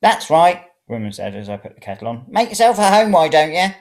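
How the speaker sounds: high, loud sarcasm; mock his cheek; sarcastic old woman. A narrator's reading. Old woman mocks him for making tea, high and sarcastic.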